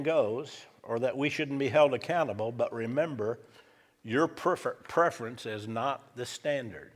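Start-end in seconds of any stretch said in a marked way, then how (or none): none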